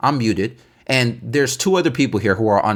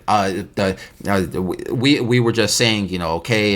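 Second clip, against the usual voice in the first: low, halting imitation of excuse-making; hesitant false starts, pitched down; stumbling over words; 5 semitones lower than usual. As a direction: mimic someone caught out, fumbling. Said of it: "stumbling over their words".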